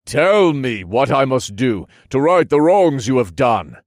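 high, forceful demand; loud command. A narrator's reading. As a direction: order it at full voice. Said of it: "I commanded as I faced Vinra again".

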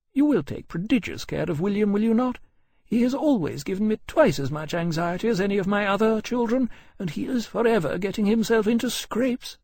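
high-pitched lady, fondly exasperated; fussing mother. Acted. Mother entrusts her son to his dragon, fussing fondly. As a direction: fuss in a high, gracious voice.

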